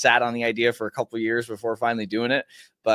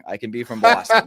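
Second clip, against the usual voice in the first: Boston accent; 5 semitones higher, 5 dB louder than usual. Shown as a demonstration. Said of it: "I can throw it out real quick".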